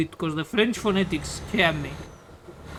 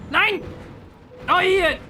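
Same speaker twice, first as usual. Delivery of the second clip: excited cheer; 11 semitones higher, slower than usual. Real, not acted. Cheering at game result.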